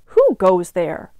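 slow, loud guard-dog challenge; growling watchdog. A narrator's reading. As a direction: challenge the intruder slowly and firmly. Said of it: "the watchdog growled".

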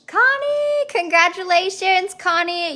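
hyped winner reveal, high and loud; excited. Real, not acted. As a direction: shout the winner's name with joy. Winner announced in giveaway.

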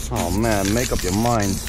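ordinary talk

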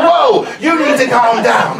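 loud, forceful calming order; urgent intervention. Retold or imitated. Shop worker telling a raging customer to stop, loud and urgent. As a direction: cut in loudly to halt someone.

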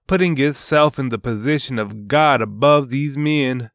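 ordinary delivery

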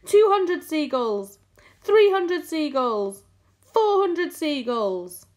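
high, loud, stretched-out counts; shouted tally. A narrator's reading. James announcing the mounting catch, shouting. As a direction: shout each total long and high. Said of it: "he shouted".

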